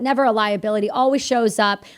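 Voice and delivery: ordinary talk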